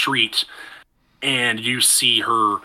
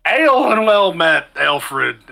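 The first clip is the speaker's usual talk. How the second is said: put-on cheerful drunk greeting, pitched higher and louder; happy drunkard; 5 semitones higher, 4 dB louder, slower than usual. Tavern drunkard greets the newcomer, merry and loud. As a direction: raise a mug and greet him like a happy drunk. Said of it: "kinda looks at you and says".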